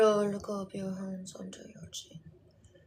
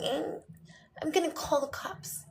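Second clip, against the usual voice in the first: put-on teenage son; 7 semitones higher than usual. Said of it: "And then he's like".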